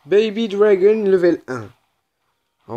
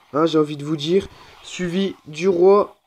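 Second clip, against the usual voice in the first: ordinary talk, nothing marked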